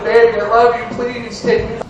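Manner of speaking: high, whiny begging; hammed-up plea to win her back; mock pleading. Boyfriend begs his girlfriend in a mock high pleading whine. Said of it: "pleading my case".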